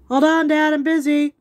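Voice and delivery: loud, high-pitched call to someone off mic; calling out to Dad. Real, not acted. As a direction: holler to Dad that you're busy. Interrupted by Dad while filming.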